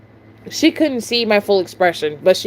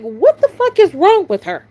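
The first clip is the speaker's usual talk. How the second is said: higher, fed-up outburst; can't believe this woman; exasperated disbelief; 8 semitones higher than usual. Retold or imitated. Cashier reacting to a customer still staring, exasperated.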